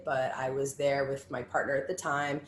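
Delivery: normal talking voice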